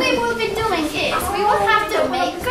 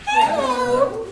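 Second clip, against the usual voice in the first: put-on child greeting; 5 semitones higher than usual. Shown as a demonstration. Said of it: "how do you say that".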